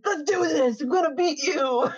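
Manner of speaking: put-on boy challenging his rival to a race, eager; competitive challenge. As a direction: play a fired-up rival. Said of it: "He's like".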